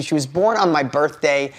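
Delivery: ordinary talk, nothing marked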